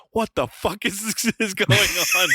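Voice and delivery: stunned disbelief. Retold or imitated. Recalling his reaction for friends, high laughing disbelief. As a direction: blurt it out half laughing in shock. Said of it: "I was like".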